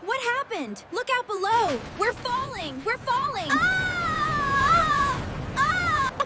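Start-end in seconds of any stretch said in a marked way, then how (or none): 3.5-6.1 high, loud scream; panicked scream. Acted. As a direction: scream as you hurtle down. Falling too fast.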